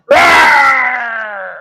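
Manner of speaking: hammed-up shouted rawr; mock bear roar. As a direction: roar at the bartender. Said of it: "And the bear goes".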